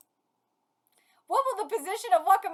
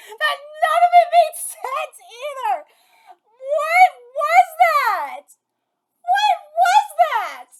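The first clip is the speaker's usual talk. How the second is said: higher, loud, slow drawn-out question; incredulous shout; 8 semitones higher, 8 dB louder, much slower than usual. Real, not acted. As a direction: wail it in disbelief. Reacting to match chaos.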